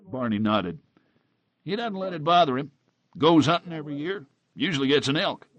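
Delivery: easygoing barman gossip; folksy bartender. Acted. Bartender character's voice.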